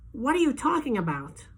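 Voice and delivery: a doctor baffled by the accusation; puzzled doctor. A narrator's reading. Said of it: "doctor replies, confused".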